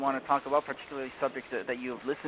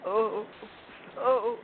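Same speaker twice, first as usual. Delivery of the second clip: sobbing fawn; 9 semitones higher, much slower than usual. A narrator's reading. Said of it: "sobbing as if his heart".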